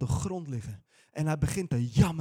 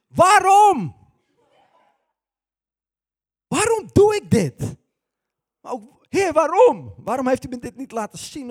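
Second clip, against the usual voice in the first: much higher, much louder yelling why; exasperated shout; 8 semitones higher, 12 dB louder than usual. Retold or imitated. Preacher retelling yelling at God after crashing his car. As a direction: yell at yourself after the crash.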